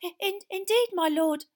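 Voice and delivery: high, loud stammering servant; nervous servant. A narrator's reading. Wormtail answering his master, nervous high stammer. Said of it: "said Wormtail".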